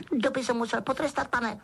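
high-pitched elf voice; Dobby explaining. A narrator's reading. Elf explaining his punishment to Harry, squeaky. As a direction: explain it like a dutiful little servant. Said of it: "vysvětlil skřítek".

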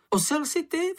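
high-pitched little creature retort; crying out. A narrator's reading. Worn-out Čenich answers Mumínek's insult, high outcry. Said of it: "vykřikl Čenich, zmořený únavou, strachem".

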